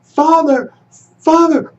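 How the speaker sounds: high urgent cries for help; calling out. A narrator's reading. Boy calling desperately for guards and father, high cries. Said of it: "Musa called out again".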